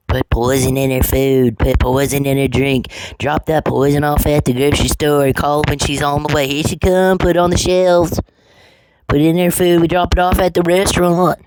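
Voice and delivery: high put-on voice, rising as it goes; mocking the plotters. Mimics the men plotting.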